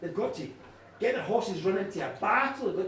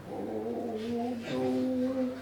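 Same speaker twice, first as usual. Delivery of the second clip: dismay; 3 dB quieter than usual. A narrator's reading. King's stricken onlooker dreading trouble.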